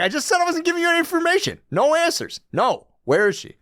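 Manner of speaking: high, fast, mock indignant outburst; Desmond's outburst. Desmond snapping at Charles in a high, fast rant. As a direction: rattle it off in a high, huffy rant. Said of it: "And Desmond's like".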